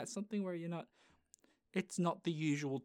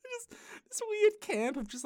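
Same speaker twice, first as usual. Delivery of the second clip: laughing. Real, not acted. Laughing at own joke.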